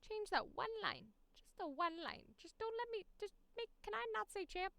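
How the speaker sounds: high, wheedling plea; high mock pleading voice. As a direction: beg sweetly like a kid. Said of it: "I'll just be like".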